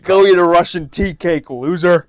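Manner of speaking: loud, high mock-Russian taunt; mock Russian accent. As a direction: hurl a playful insult in a Russian accent. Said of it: "can I do a Russian accent?".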